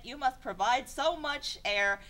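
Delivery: usual speaking voice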